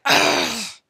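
loud groan. A narrator's reading. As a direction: groan sick. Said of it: "Tom lay down and said".

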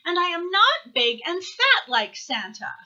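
mildly indignant little owl; lightly voiced Baby Owl. A narrator's reading. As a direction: object like an affronted little owl. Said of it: "said Baby Owl".

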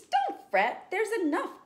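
high, loud squeaky voice; squeaky mouse. Acted. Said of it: "Mouse squeaks".

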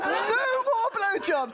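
high, drawn-out mock shout; declaring loudly. Woman vows reform at town meeting, declaring it high. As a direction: proclaim it high and slow.

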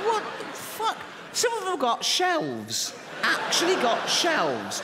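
high, slow, squeaky disbelief; incredulous squeal. Acted. Comedian squealing in disbelief at passengers with shelves. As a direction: squeal it like you can't believe your eyes.

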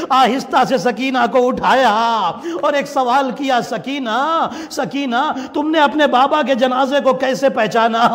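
keen the story to the mourners; high-pitched lament. Acted. Preacher retelling the sisters' question to Sakina, high wailing lament.

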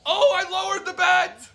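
high, loud disbelieving screams; ecstatic screaming. Real, not acted. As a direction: scream in shocked delight. Huge win after lowering bet.

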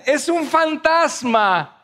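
high, drawn-out mock alarm; frightened cry. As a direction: cry out in pretend fright, stretching each word. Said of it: "se asustaron y decían".

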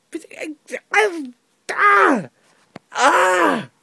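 high, loud stammer into wordless cries; splutter, then wail in exasperation; exasperated wail. Real, not acted. Reviewer despairing at today's films, exasperated wailing.

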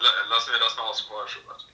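normal talking voice

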